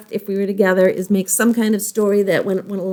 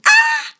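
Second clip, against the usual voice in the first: short, very high mock scream; playful scream. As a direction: squeal out a pretend scream for the kids. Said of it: "don't forget to scream".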